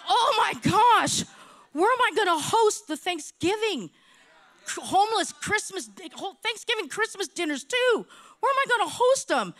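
high-pitched flustered worry; comic panic. Retold or imitated. Restaurant owner asking where to host holiday dinners, mock panic. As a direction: fret it high, hammed-up.

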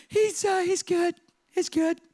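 panicked lie. Retold or imitated. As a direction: cover the lost son in a panic. Joseph lying nervously.

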